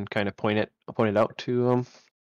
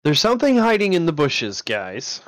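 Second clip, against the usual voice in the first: raised, higher warning to the party; calling out warning; 5 semitones higher, 5 dB louder than usual. Acted. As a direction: call out to alert your companions. Character warns party of hidden creatures.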